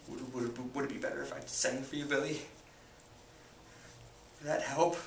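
soft, low, hesitant farewell; tender grief. Acted. Sibling letting dying brother go, tender grief. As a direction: speak gently at a bedside.

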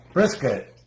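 sharp call to the dog. Real, not acted. Calling the dog by name.

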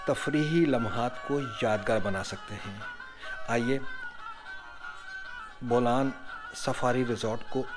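soft, measured resort narration; travel voice-over. Acted. Narrator introduces Bolan Safari Resort to viewers, soft scripted voice-over.

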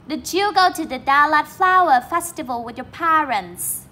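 careful, clear classroom read-aloud; model reading. Shown as a demonstration. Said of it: "Câu này các bạn đọc lại nha".

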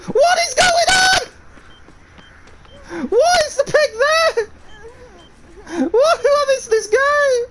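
very high, loud, slow hammed-up crying shout; mock wailing. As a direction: wail in playful despair at the game. Despair at glitch, crying shout.

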